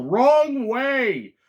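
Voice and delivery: high, drawn-out imitation of a yell; mock yell. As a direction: call it out high, as if yelling from afar. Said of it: "you hear somebody yell".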